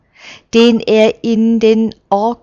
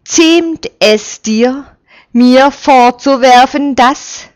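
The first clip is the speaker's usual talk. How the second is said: angry retort; 5 semitones higher, 5 dB louder than usual. A narrator's reading. Stung official angrily rounding on the accuser. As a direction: bristle with offended fury. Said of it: "Zornig steht er auf".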